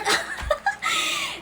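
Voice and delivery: very high squealing laughter; shrieking laugh. Real, not acted. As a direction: burst into a squealing giggle. After "char" joke. (matinis na tili-tawa)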